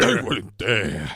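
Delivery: slow, low Pacino line; Al Pacino impression. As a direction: drag it out like Pacino. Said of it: "that impression of you going".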